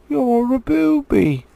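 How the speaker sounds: high, loud, slow jeering; squeaky troll insult. Acted. William the troll jeering at Tom, high and slow. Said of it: "said William".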